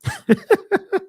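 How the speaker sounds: short, high burst of laughter; amused laugh. Real, not acted. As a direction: laugh at the absurdity. Laughs at his own mockery.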